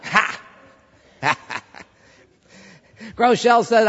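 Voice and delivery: ordinary delivery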